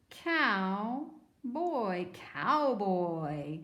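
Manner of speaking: put-on "Cow" and "boy" apart, then joined as "cowboy"; drawn-out teacher's blend of "cowboy"; compound sounded out. Shown as a demonstration. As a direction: say each half slowly, then blend "cowboy". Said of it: "It's a compound word, two words together".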